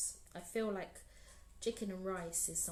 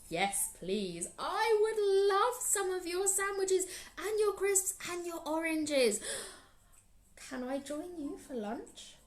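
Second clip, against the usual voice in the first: higher, louder, eager grinning voice; greedy spider voice; 10 semitones higher, 6 dB louder than usual. Acted. Anansi begs Lion to join his lunch, high and eager. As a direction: grin greedily while asking. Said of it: "Anansi gave a great big grin".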